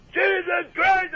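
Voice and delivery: high, slow, stretched cry of shock; shocked outburst. Retold or imitated. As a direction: howl it out as the zapped man did. Shocked wrestler's outburst retold.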